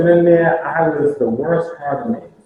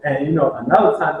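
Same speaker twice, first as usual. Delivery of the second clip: usual speaking voice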